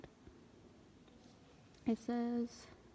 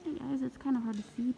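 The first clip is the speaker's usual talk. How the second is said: normal talking voice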